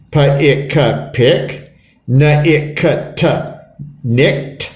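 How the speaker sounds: Pa-ik-ka, pick, then Na-ik-ka-ta, nicked; sounded out. Shown as a demonstration. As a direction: break it into sounds, ending nicked on a t. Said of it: "the way this word is sounded out".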